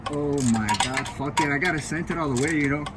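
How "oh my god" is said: normal talking voice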